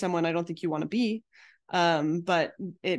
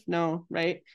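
normal talking voice

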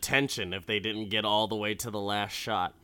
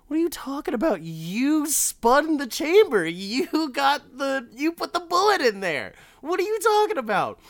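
much higher, disbelieving whine; incredulous high protest; 6 dB louder than usual. Retold or imitated. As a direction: protest like you can't believe it.

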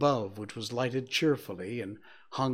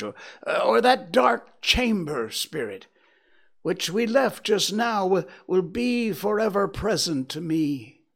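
pleading cry; 7 semitones higher, 6 dB louder than usual. Acted. Scrooge pleads with the spirit.

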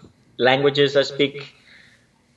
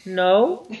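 higher, drawn-out mock-innocent no; unconvincing little denial, about to laugh; feigned innocent denial; 5 semitones higher than usual. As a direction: fib lightly with a raised, questioning tone. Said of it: "I'm like".

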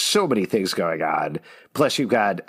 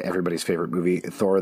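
usual speaking voice

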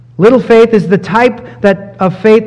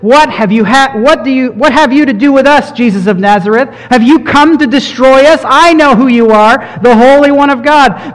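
demon crying out; 6 semitones higher than usual. Acted. Preacher voicing the demon's cry to Jesus, raised and urgent. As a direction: cry it out as the possessed man facing Jesus. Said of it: "and cried out".

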